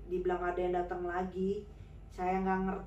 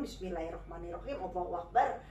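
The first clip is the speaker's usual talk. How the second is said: quiet prayer; 5 semitones lower, 5 dB quieter than usual. Retold or imitated. Murmured prayer before drinking.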